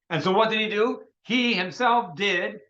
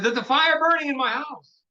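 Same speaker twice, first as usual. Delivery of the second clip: urgent cry; 8 semitones higher than usual. Retold or imitated. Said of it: "And he said".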